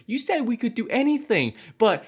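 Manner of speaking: high, whiny mock kid voice demanding; hammed-up high girl voice insisting; mock little girl. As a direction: squeak it out like a bratty kid mocking her. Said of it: "this little girl goes to her teacher and is like".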